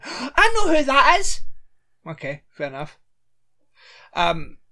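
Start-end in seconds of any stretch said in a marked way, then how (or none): 0.0-1.4 high, loud sudden recognition; excited realisation. Acted. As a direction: blurt it out in shock. Acting Olivia's recognition.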